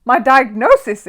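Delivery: high, loud, slow alarmed cry; startled little bear. A narrator's reading. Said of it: "exclaimed Paddington in alarm".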